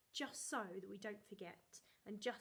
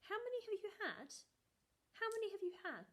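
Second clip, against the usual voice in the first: mum's questioning voice, pitched higher; a mother grilling her son about sweets; mum asking; 10 semitones higher, faster than usual. Retold or imitated. Mother questioning her son about chocolates, mildly mock-stern. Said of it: "my mummy kept saying to him".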